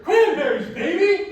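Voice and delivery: loud, high enthusiastic cheer; gusto. Shown as a demonstration. Comedian shows the crowd how to cheer the troupe name, with gusto. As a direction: shout it like a crowd warm-up. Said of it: "do it with a little bit of gusto".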